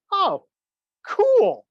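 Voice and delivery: high, pleased little exclamation; impressed reaction. Retold or imitated. Reacting to a TV news director, high mock delight. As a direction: act out being impressed, pitch jumping up.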